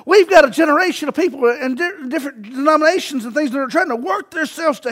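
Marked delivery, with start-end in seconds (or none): none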